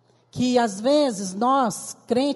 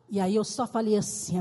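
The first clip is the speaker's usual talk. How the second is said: normal talking voice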